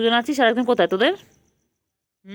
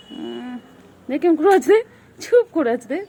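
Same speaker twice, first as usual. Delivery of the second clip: higher and louder, gushing; delighted squeal; 8 semitones higher, 5 dB louder than usual. Real, not acted. Gushing to the kitten, excited and high. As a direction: gush over how still she sits.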